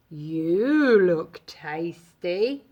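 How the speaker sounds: low, slow, lowing cow voice; mooing cow. A narrator's reading. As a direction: draw it out like a hungry cow. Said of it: "mooed the cow".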